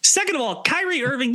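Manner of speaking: reclaim the floor, raise the voice; emphatic restart. Real, not acted. Restart to cut through interruption.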